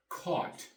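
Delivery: "Caught." said kawt, G H silent. Shown as a demonstration. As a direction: say "Caught." with no G H sound. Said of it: "The G H is silent".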